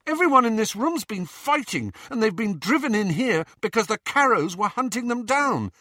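very high, heated protest; impassioned argument. Acted. Arguing with friends who won't trust them, impassioned. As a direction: pour out a high-pitched, indignant appeal. Quarrel over being trusted.

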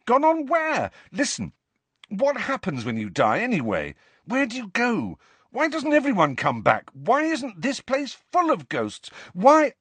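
high-pitched volley of demands; urgent questioning. A narrator's reading. Harry demands what happens after death, urgent. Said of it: "said Harry quickly".

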